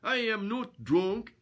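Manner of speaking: high, loud, slow mock-Swedish roar; indignant Swedish bellow, each word drawn out. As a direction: roar the denial in a comic Swedish accent. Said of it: "he roared".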